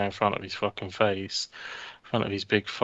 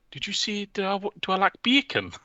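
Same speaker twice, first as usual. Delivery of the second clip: very high, dazed Geordie mumble; confused answer in a put-on high voice; Steve Bruce impression; 11 semitones higher than usual. Steve Bruce answering a reporter, lost and high-pitched. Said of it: "And he's like".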